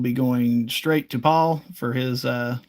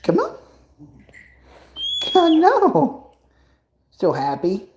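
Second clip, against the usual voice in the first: much higher squeaky cooing; 12 semitones higher, 3 dB louder, slower than usual. Real, not acted. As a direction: coo up high at your pet. Baby talk to pet bird.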